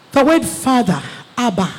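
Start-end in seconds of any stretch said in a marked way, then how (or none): none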